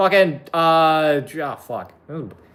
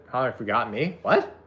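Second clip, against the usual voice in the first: normal talking voice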